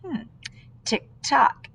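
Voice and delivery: usual speaking voice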